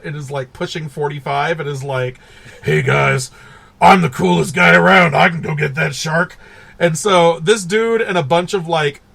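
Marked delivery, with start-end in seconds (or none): 2.6-6.4 lightly voiced cocky bully, a bit louder; mock high school bully. Mocking the movie's aging bully character, lightly cocky. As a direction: swagger it like a washed-up bully. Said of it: "archetype of your high school bully".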